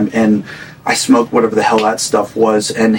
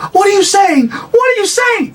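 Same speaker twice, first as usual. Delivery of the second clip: put-on scared shouting girlfriend. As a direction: recoil against the wall and cry it out in panic. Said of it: "like she's an, a scared animal".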